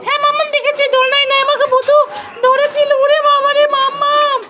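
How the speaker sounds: put-on high, even child-character voice; child character, frightened. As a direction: report the scare as a little one. (বাচ্চার সরু গলায় ভয়ের কথা বলা)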